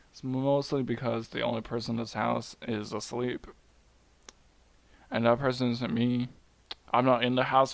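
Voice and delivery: normal talking voice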